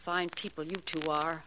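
normal talking voice

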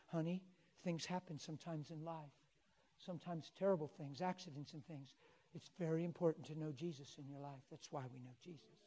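soft, hushed consoling voice; comforting a child. Retold or imitated. Preacher rocking a shaken granddaughter, comforting. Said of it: "rocked her and told her".

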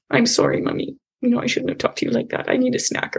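a hint of a contrite kid piping up; apologetic little child. Sorry child apologizing to mommy, lightly voiced and quick.

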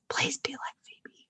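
pleading whisper. Pretend. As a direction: whisper it as a soft plea. Begging listeners in asides.

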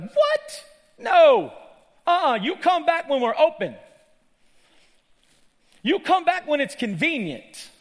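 high, loud, annoyed shouting; indignant synagogue ruler. Acted. Annoyed ruler sends the woman away, indignant shout. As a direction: turn them away with outraged refusals. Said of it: "he was indignant. That means he was annoyed".

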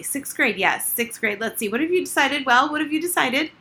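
high, loud flustered gym teacher; hysterical. A narrator's reading. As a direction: rattle it off in a frantic flap. Hysterical Miss Barry.

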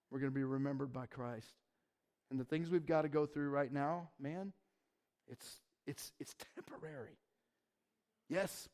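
quiet, hushed reassurance; soft intimate preaching. Real, not acted. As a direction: draw them close, gently.

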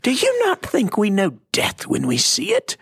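hammed-up British accent. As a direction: overdo the posh British lady's retort. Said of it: "she had a British accent".

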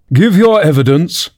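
high, commanding king; king's first order. A narrator's reading. The king orders the nervous Hatter to testify, commanding. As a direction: order the witness at normal volume. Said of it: "the king repeated angrily".

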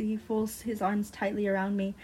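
ordinary delivery

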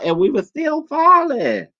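high-pitched, drawn-out amazement; excited retelling. Retold or imitated. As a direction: stretch it out high, reliving the drop. Reliving the endless drop.

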